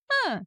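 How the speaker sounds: demonstrated perky voice sound; high chirpy "huh". Shown as a demonstration. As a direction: squeak out a cheery little noise. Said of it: "kind of voice".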